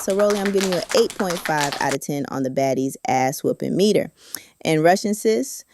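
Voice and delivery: normal talking voice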